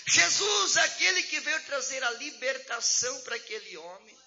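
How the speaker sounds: preaching at full force. Acted. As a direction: preach it out loud and high to the church. Preacher climax, shouting refrain. (pregação exaltada, em voz alta e forte)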